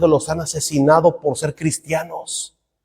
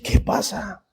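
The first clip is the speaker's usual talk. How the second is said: whisper; 9 dB quieter, slower than usual. Retold or imitated. Bewildered preacher facing crowd.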